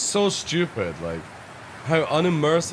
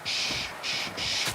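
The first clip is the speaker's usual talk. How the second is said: hushing whisper; 10 dB quieter than usual. Pretend. Sneaking past an enemy.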